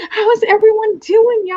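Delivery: playful stretched-out Southern greeting, laughing; little Southern. Pretend. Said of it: "I got a little Southern".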